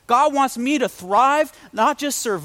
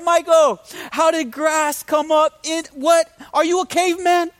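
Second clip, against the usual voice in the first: mock-exasperated scolding, pitched up; exasperated wife; 5 semitones higher than usual. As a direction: act out an incredulous wife calling out her husband. Wife aghast at grass in bathtub.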